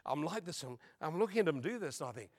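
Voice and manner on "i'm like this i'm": ordinary talk, nothing marked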